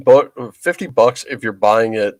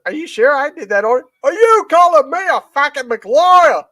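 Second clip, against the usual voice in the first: mock-offended Australian, building louder; Australian accent; 13 semitones higher, 4 dB louder than usual. Comedian feigning offence at the cashier, Australian accent. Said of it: "good Australian accent".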